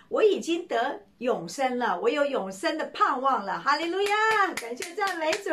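high, loud exultant hallelujah; young man rejoicing he has eternal life; joyful praise. Acted.

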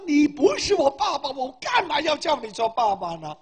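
loud, high indignant retort; retold anger. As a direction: imitate an offended man protesting. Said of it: "他很生气".

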